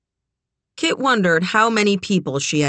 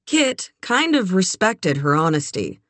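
usual speaking voice

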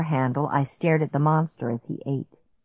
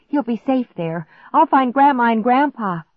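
higher-pitched, pressing instructions; urgent order; 6 semitones higher, 4 dB louder than usual. A narrator's reading. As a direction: take charge urgently. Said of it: "I ordered Clark".

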